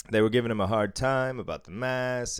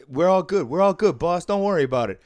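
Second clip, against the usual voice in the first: louder, higher calming plea; raised placating voice; 6 semitones higher, 7 dB louder than usual. Retold or imitated. Friend pulling his buddy back, pleading loudly. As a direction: soothe the boss loudly, hands up.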